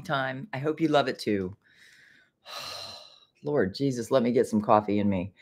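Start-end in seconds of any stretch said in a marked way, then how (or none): 2.5-4.1 sighing. Real, not acted. Weary sigh before coffee.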